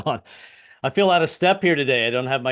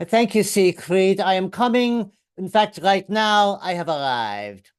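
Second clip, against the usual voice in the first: put-on slow, higher-pitched German accent; 5 semitones higher, 4 dB louder, slower than usual. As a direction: answer the lover slowly in a stiff German accent. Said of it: "uh, the German accent".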